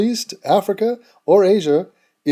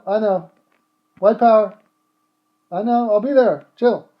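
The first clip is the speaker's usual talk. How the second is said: higher, offhand voice, chatting to a mate on the phone; 5 semitones higher than usual. Acted. Skinhead telling a friend he'll be late, casually. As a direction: brush off a friend calling, casual and a bit higher. Fake phone call as bigot.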